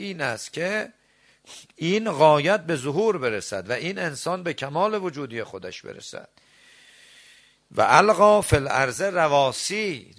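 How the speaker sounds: loud, high, impassioned sermon voice; preaching at full force. Acted. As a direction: drive the verse home to the congregation. Climactic preaching on the believer.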